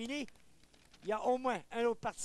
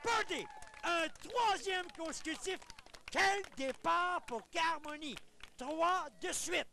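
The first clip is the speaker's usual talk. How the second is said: excited golf commentator; 7 semitones higher than usual. Real, not acted. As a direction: call the birdie with rising excitement. Birdie streak on the green.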